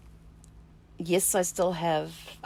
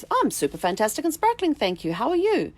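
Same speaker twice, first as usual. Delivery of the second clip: chirpy, much higher, sing-song greeting; bubbly cheerful reply; 9 semitones higher than usual. Retold or imitated. Cheerfully greeting a cashier at the supermarket, bright and high. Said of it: "And you go".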